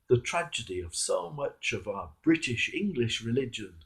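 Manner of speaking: usual speaking voice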